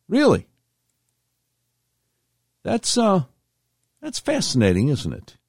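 sharp high disbelieving "really"; a scoffing, high "Really?"; incredulous. Real, not acted. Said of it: "incredulous aside".

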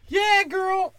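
squealing heckler. Retold or imitated. Comic mocks a heckler cheering women, squealing high. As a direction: squeal it like an overeager fan. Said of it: "there's some bitch".

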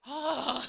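big, loud relieved "oh"; sigh of relief. Retold or imitated. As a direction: let out a high, exaggerated sigh of relief. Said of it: "I breathed a sigh of relief".

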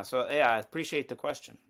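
normal talking voice

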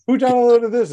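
loud, high startled question; alarmed shout. Retold or imitated. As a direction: yell it out in alarm. Alarmed at mystery download.

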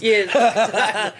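laughing. Real, not acted. Laughing at the joke.

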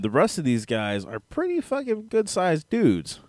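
usual speaking voice

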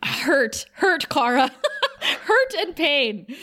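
laughing dramatic. Real, not acted.

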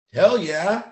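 loud, high burst of conviction; emphatic outburst. Real, not acted. Speaker answering own questions about fear, emphatic outburst.

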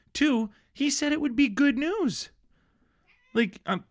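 high-pitched, slow, disbelieving complaint; exasperated rant. Real, not acted. Co-host ranting about a bad news tease, exasperated and high. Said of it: "I'm livid about this".

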